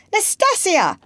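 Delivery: high call for a servant. A narrator's reading. Lady summoning her maid from the door, calling out. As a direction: call the name out through a doorway. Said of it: "opened it, and called out".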